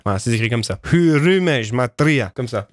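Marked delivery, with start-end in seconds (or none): 0.9-2.3 Hurumège Matria drawn out slowly, each syllable stressed; slow careful pronunciation. Shown as a demonstration. Streamer showing chat how the name sounds, slow and deliberate. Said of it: "Et ça se prononce un peu comme ça, avec un genre d'accentuation".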